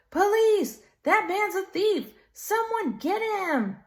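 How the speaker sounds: high, loud desperate shout; shouting for police. A narrator's reading. Robbed man calling police after Uncle Sly, high loud shout. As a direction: holler down the alley for the cops. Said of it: "the man shouted".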